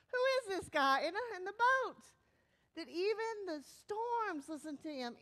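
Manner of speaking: loud, high, freaked-out amazement; hammed-up alarm, easing toward the end; mock terrified. Disciples in the boat about Jesus, mock terrified. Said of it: "it says they're terrified".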